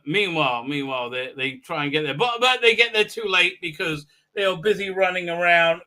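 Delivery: usual speaking voice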